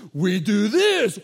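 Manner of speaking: loud, high, drawn-out boast; hammed-up muscleman. Muscle guy answering talk show host, hammed-up loud boast. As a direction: show off like a pumped-up bodybuilder.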